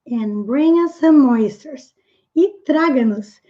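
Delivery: ordinary talk, nothing marked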